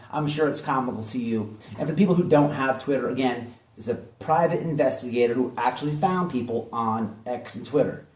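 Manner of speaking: ordinary delivery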